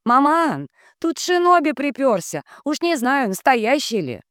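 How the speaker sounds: put-on high girlish call over shoulder. A narrator's reading. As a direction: shout back to mother, high. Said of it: "крикнула".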